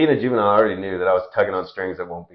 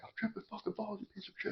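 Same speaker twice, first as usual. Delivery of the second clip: much higher, soft, breathy snarl at dad; hissed fury; 13 semitones higher, 22 dB quieter than usual. Retold or imitated. Son pinning his dad against the Jeep, higher hushed snarl. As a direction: hiss the insult right in his face. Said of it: "I was like".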